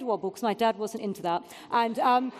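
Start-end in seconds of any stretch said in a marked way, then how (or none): none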